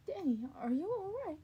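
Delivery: high character voice, detached; unconcerned Nezu. A narrator's reading. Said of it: "though he didn't really sound concerned".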